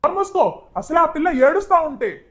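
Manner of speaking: high, loud snap to shut up; angry shushing. Acted. Father shuts the elder daughter up, loud and high. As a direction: cut her off sharply.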